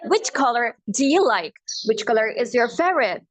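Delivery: slow American accent, R in "color". Shown as a demonstration. Teacher models color question, American accent. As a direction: sound every R, American. American take before British conversion.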